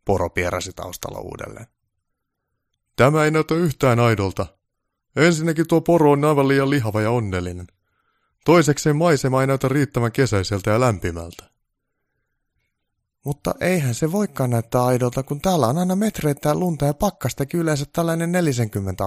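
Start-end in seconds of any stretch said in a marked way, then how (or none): 2.9-11.5 loud, high, fault-finding voice; haughty on-set criticism, pitched up; pompous director, displeased. Acted. Voices Baltasar criticising the footage. 13.2-19.1 high-pitched, loud objection; earnest little elf thinking aloud; elf foreman, protesting. Acted. Voices Onni objecting to the director.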